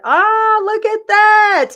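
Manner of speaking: light up at a lovely surprise; thrilled, awed ah; delighted gasp. Real, not acted. Sees the tattoo on camera.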